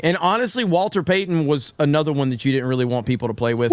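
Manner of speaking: ordinary delivery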